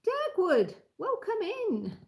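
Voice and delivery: high, drawn-out welcome; cheery welcome. Real, not acted. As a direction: greet the newcomer brightly. Greeting viewer on live stream.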